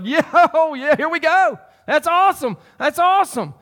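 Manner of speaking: high, loud burst of imagined excitement; excited Moses cheering. Acted. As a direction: whoop it up like a thrilled Moses. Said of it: "he probably was resonating with a".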